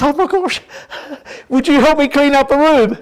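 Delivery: put-on high, loud girlish plea; pleading daughter. Daughter begging dad to help clean. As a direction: beg like an eager young daughter. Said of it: "And she says".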